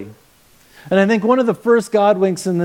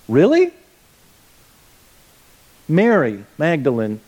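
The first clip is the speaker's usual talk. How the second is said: incredulous; 3 semitones lower than usual. Real, not acted. Preacher scoffing at Mary's offer to carry Jesus, incredulous. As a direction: pitch it up in mock disbelief.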